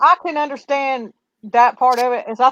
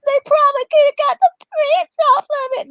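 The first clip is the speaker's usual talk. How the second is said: much higher, put-on little-girl voice; mock her fake sweet phone voice; conjured voice. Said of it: "like a conjured voice".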